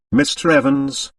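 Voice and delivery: ordinary delivery, nothing marked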